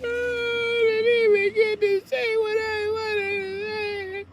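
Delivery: high falsetto whine, slow and tearful; mock whiny crying. Family women whining that nobody hears them, mock crying. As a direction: whimper like a wronged sister. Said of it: "Then they get mad, like".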